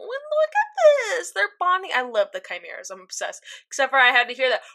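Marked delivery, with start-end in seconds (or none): 0.0-1.9 gushing coo. Retold or imitated. Retelling own reaction to the bonding Chimeras, gushing coo. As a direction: coo over them in a very high voice.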